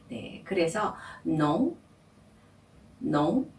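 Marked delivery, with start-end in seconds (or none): 1.2-3.5 "non" said with the o pushed up into the nose; nasal vowel on "non", slow. Shown as a demonstration. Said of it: "이렇게 발음하시면 되고요". (코로 올려 발음하는 비강 모음 "non")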